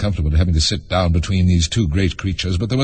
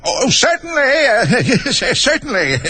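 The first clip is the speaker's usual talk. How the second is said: put-on high, dithering old-king voice with nervous laughter; very high, flustered storybook king; flustered muttering king; slower than usual. A narrator's reading. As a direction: dither squeakily, stumbling and tittering. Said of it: "the king muttered".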